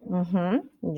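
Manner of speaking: usual speaking voice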